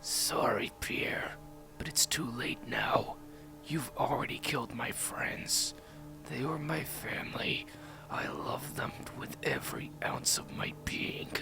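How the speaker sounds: whispered growl into an enemy's face; slow, hushed menace, all whisper. A narrator's reading. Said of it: "growled in his face".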